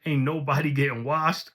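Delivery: ordinary delivery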